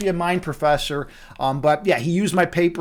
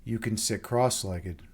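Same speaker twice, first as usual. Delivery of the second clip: much lower, much softer, slow meditation voice; guided meditation; 9 semitones lower, 14 dB quieter, slower than usual. Acted. Host guiding viewers into meditation, soft and slow. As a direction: ease them down into stillness with long pauses.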